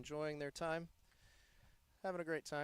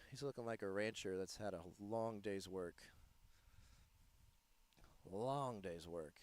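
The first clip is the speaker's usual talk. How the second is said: quiet; 7 semitones lower, 8 dB quieter than usual. Acted. Admiring a rancher-like face.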